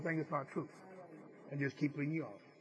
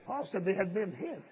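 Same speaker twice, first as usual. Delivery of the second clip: louder, slower preaching; rising preaching; 6 dB louder than usual. Acted. Preacher exhorting the congregation, rising fervor. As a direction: build the sermon to its peak.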